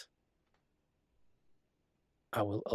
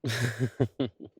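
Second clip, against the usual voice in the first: a short laugh; 3 semitones higher, 5 dB louder than usual.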